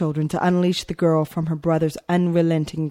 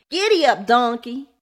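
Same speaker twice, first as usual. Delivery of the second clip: high taunting yell at his sister; 6 semitones higher, 4 dB louder than usual. A narrator's reading. Boy taunting his little sister, yelling. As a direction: yell it like spurring on a mule.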